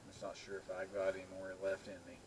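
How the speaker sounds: ordinary talk, nothing marked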